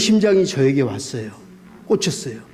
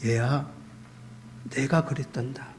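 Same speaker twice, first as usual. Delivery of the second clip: soft, lower, quiet fatherly comfort; tender voice of God; 4 semitones lower, 11 dB quieter than usual. Retold or imitated. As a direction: speak it gently like a grieving father. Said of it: "하나님이 저에게 마음속에 그러는 거야".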